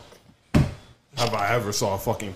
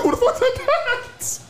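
mock parrot; 4 dB louder than usual. Imitating parrot's Trini voice.

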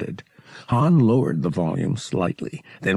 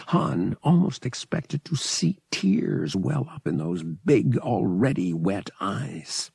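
ordinary delivery, nothing marked

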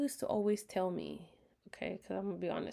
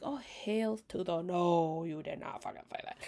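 hell-to-the-no attitude, dropping to a hiss at the end; sassy indignation; 2 semitones lower than usual. Retold or imitated. Retold reaction to his words.

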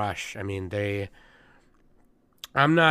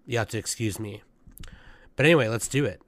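usual speaking voice

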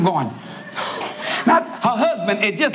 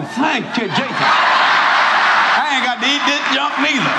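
relieved dog; 5 semitones higher, slower than usual. Retold or imitated. Dog in the corner thanks Jesus, slow relieved. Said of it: "the dog in the corner going".

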